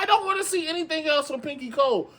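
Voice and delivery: fake-cry the complaint; half-sobbing exasperated plea; mock crying. Real, not acted. Exasperated plea about brand.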